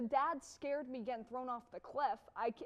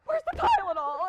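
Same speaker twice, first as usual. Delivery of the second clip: loud, higher, hammed-up outburst; mock rampage; 6 semitones higher, 11 dB louder than usual. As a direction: imitate a movie dad blowing up. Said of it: "ten-minute rampage and it's just like".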